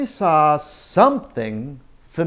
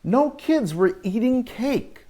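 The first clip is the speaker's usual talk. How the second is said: usual speaking voice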